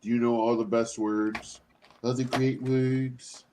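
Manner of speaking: a light, slow, soft take on a boastful politician; Trump impression. Teasing friends with a lightly voiced boastful politician, slow and soft. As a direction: lightly mimic a bragging politician. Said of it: "Is that your impression of Trump?".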